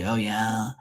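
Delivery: lightly voiced fake psychic; airy put-on psychic reading; mocking the rival medium. Mocking a rival medium's live reading, lightly voiced. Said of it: "go like this and say".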